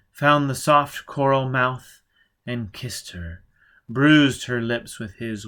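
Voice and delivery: plain reading voice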